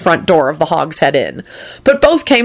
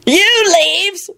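shouting with laughter; 13 semitones higher than usual. A narrator's reading. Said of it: "Lupin shouted and began laughing".

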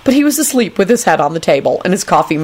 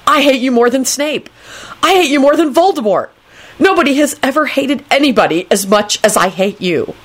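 high, emphatic declaration of hate; worked-up, high-pitched loathing; exasperated; 7 semitones higher than usual. A narrator's reading. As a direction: rant it with raised pitch. Exasperated outburst of hatred.

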